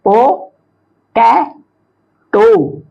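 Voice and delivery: ओ, टे, टो slowly, each alone; three separated beats: ओ, टे, टो; syllable by syllable. Shown as a demonstration. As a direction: pause after every syllable. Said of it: "ये ट्राइसिलेबिक word हो गए".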